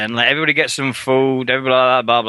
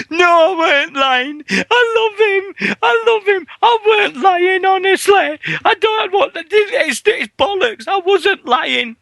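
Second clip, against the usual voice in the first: much higher, slow, hammed-up crying plea; mock sobbing guest; 4 dB louder than usual. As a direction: parody a weeping guest on the lie detector. Mocking crying talk show guest.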